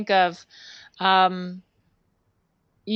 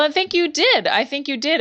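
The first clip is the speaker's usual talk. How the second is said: ordinary talk, nothing marked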